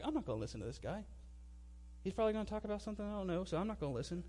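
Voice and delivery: quick, hushed aside to oneself; retold private thought, soft and hurried; muttered inner refusal. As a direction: mutter it dismissively under your breath. Inner thought while tuning preacher out.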